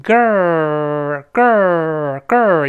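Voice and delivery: put-on "girl" stopped on a long drawn-out r, no l; curled-tongue r. Shown as a demonstration. Teacher building the word for learners, long r in "girl". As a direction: stretch the curled r of "girl" very slowly. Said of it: "字母R再勾舌头".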